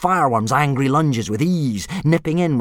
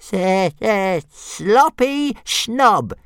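much higher, louder, very slow stammering jeer; jeering dragon; 11 semitones higher, 4 dB louder, much slower than usual. Acted. Toothless jeering at Fireworm, high and slow. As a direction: drag out the taunt in a squeaky stutter. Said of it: "jeered Toothless".

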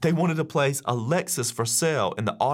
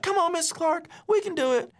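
put-on high-pitched kids begging their teacher; a classroom of kids urging him on, much higher; pleading kids. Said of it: "But they were like".

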